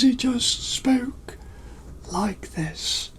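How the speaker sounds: high slow whisper; Dumbledore voice. Retold or imitated. Storyteller showing his reading voice, high whisper. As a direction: whisper like a dotty old wizard. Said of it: "Dumbledore's was an easy one to do".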